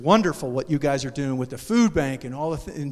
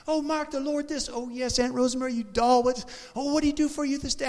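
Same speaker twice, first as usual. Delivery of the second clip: high, sweet churchy gush; gushing aunt talk; 8 semitones higher than usual. Retold or imitated. Imitating his aunt and himself on the phone, high gushing. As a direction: gush about the Lord like a doting aunt. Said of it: "That's how we talk".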